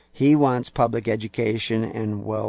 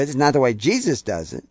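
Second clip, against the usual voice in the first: usual speaking voice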